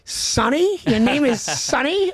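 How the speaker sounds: high-pitched disbelief, rising at the end; incredulous mocking question. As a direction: imitate a dad scoffing at a name. Dad mocking a stranger's name.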